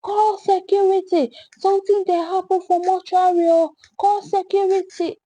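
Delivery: high alarmed shout for help. A narrator's reading. Janitor runs off calling for security, shouting high. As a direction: yell it down the hallway. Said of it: "ran back shouting".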